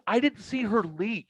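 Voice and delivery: high, slow, mystified; retold disbelief. Son baffled by her vanishing.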